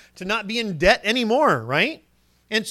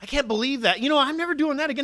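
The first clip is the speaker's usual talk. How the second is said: higher, fast, a touch of disgust; indignant self-rebuke; 7 semitones higher, faster than usual. Acted. Preacher acting out anger at his own sin, higher and fast. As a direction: scold yourself for the old sin. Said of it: "it's an anger towards that sin".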